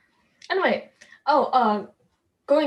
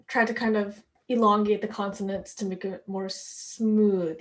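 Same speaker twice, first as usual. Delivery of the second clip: ordinary delivery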